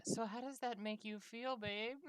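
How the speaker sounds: high-pitched mock therapist voice; Doctor Octavia. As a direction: lightly play a therapist probing a patient. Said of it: "I'm just seeing Doctor Octavia".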